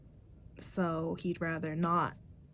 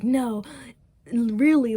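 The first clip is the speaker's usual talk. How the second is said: louder, higher insistent denial; emphatic no; 4 semitones higher, 8 dB louder, slower than usual. Retold or imitated. Past-life girl insists love saves no one, loud. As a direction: insist hard that love fails.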